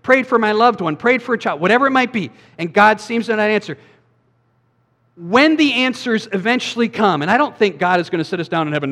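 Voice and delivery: normal talking voice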